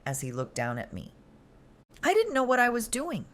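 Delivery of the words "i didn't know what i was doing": high, shaking gasped plea. Acted. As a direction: gasp it out, barely holding together. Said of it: "I gasped".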